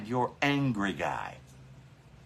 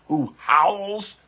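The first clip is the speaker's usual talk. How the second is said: ordinary delivery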